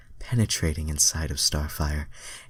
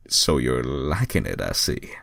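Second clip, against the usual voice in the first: Sonic deep voice; 4 semitones lower than usual. Acted. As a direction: drop the voice low and smug. Sonic's line in fanfic.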